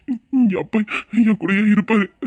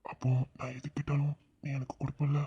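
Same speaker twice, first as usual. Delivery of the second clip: lower, soft flirtatious question; low teasing murmur; 5 semitones lower, 13 dB quieter than usual. Acted. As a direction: tease her in a low murmur. Husband's teasing romantic murmur. (குறும்பான மெல்லிய குரல்)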